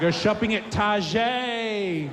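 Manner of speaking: slow, drawn-out "Target" said tar-ZHAY; French-style pronunciation. Acted. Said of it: "a little bit of a French sort of style".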